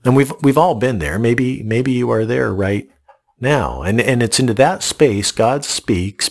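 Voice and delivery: normal talking voice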